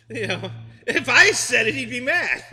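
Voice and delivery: high-pitched laughter through the words; laughing. Real, not acted. Laughing while telling his friends what would happen if he said it. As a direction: laugh it out, can barely finish.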